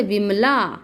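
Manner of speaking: विमला with the final "laa" long and open; wide-open long vowel. Shown as a demonstration. Teacher giving an example of the aa sign, wide-open long vowel. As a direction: stretch the ending of विमला, mouth opened firmly. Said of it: "मात्रा लगी हुई है तो ज़ोर से खोलना है".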